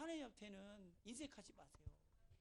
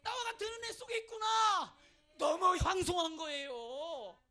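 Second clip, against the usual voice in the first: overwhelmed shout; 4 semitones higher, 12 dB louder than usual. Acted. Climax of sermon.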